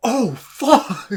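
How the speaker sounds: loud, high startled cry; shocked yell. Retold or imitated. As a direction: blurt it out in shock. Retold shock reaction.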